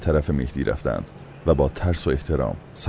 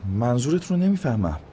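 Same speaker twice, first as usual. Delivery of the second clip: higher, puzzled surprise; baffled, asking what he means; surprised companion, higher voice; 9 semitones higher than usual. A narrator's reading. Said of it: "با تعجب گفت".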